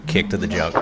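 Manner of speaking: normal talking voice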